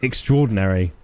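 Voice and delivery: normal talking voice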